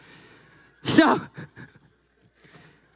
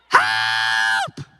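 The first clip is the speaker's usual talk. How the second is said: mock cry for help; 13 semitones lower than usual.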